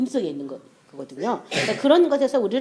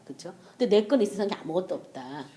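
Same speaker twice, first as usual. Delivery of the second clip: ordinary talk, nothing marked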